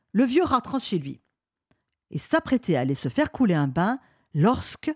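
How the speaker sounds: ordinary delivery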